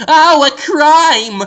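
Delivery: high, slow, loud hammed-up alarm with a laugh; mock secretary cry. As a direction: shriek it like a panicked ad secretary. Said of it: "in a dress with a red wig going".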